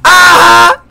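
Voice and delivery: crowing shout with a drawn-out finish; loud taunt. Acted. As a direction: throw it at him loud and proud. Taunting a rival before the group.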